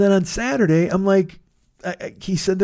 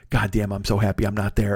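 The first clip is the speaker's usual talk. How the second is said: low, softer gloating friend; lightly voiced smug pal; smug relief; 8 semitones lower, 5 dB quieter than usual. Quoting his friend gloating about missing the trip, low and smug.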